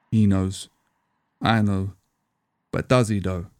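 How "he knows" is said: ordinary delivery, nothing marked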